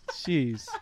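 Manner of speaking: ordinary talk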